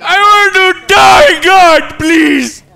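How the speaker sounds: high, loud, slow wail; hammed-up despair shout. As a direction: drag out a theatrical cry to heaven. Melodramatic despair.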